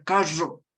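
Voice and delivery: "carro" said the way the erring presenter said it; presenter's mispronunciation. Retold or imitated. Mocking a TV presenter's mispronounced "carro". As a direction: imitate the presenter's faulty "carro". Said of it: "había uno que decía".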